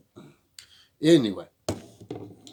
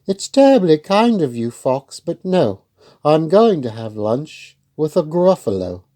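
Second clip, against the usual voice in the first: higher, louder little mouse, politely refusing; a brave little mouse with a higher voice; plucky mouse; 5 semitones higher, 10 dB louder than usual. Acted.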